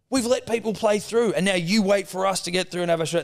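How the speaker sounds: high-pitched indignant telling-off; retold scolding. Dad to young golfer about playing through, mock indignation. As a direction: lay down the rules as an irate dad.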